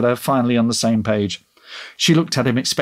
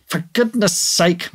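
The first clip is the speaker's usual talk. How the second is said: drawn-out, higher-pitched exasperation; exasperated mutter; 7 semitones higher, slower than usual. A narrator's reading. Tasha fed up with being ignored at the counter, exasperated. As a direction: let the words drag out in exasperation. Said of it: "muttered Tasha".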